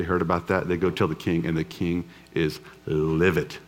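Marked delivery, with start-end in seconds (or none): none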